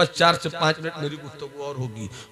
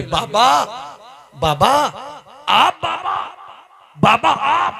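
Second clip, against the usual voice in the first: high, loud amazed calling out; astonished cry; 11 semitones higher, 5 dB louder than usual. Acted. Woman sees the saint at her door, loud amazed cry. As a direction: gasp out Baba in disbelief.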